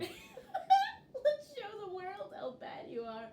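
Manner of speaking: high, slow, giggly tease; gleeful laughing delivery; laughing taunt. Real, not acted. Laughs while teasing the other player.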